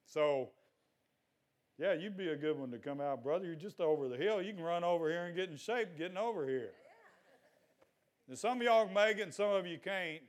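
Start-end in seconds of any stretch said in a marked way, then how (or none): none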